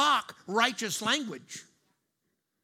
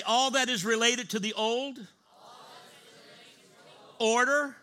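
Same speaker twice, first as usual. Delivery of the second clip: building proclamation; 3 semitones higher, 5 dB louder, slower than usual. Shown as a demonstration. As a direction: build it up and shout behold.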